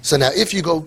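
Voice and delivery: ordinary talk, nothing marked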